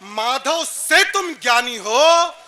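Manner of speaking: drawn-out verse, falling low at the close; poetry recitation. Acted. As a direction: recite with stately, stretched phrase endings. (ठहराव के साथ वीर रस काव्य पाठ)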